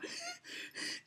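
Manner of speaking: high breathless gasp; emotional gasp. Real, not acted. Runner nearly crying at the finish, sharp breathless gasp. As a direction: catch your breath, overwhelmed. Overwhelmed near finish line.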